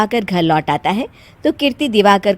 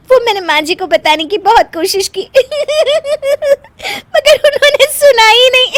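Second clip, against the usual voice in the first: high, giggling explanation broken by laughs; high crying laughter; laughing explanation; 6 dB louder than usual. Acted.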